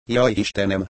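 plain reading voice

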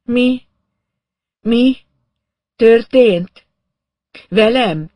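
high, loud, halting word by word; groggy woman groping for words; dazed moaning. A narrator's reading. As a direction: moan it in shocked fragments. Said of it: "Nem volt magánál annyi szent".

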